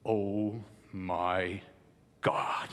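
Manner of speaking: low, soft gasped "oh my God"; modern astonishment. Shown as a demonstration. Coach contrasting today's astonishment, a low gasp. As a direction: gasp it, stunned. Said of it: "it is almost as when people say".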